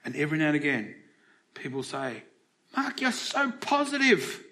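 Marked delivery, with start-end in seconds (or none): 2.8-4.4 high, drawn-out gushing compliment; slow high-pitched mock praise; imitated admirer. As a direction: mimic an admirer in a much higher, slower voice. Acting out people's remark to him.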